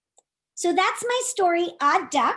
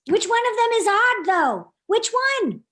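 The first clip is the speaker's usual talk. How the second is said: put-on higher, louder quiz to kids; playful question; 7 semitones higher, 3 dB louder than usual. Storyteller asking children which duck is odd, high and loud. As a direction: tease the audience to guess.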